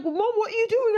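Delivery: high, loud startled call to Mom; imitating the boys' startled yell; alarmed kids. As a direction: shout it up high like a surprised kid. Boys see mom run out.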